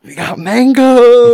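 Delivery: big reveal. Pretend. Revealing mango as number one to friends, slow hyped reveal. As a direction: land the winner like a game-show host. Announcing top pick to friends.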